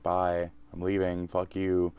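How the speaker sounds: ordinary talk, nothing marked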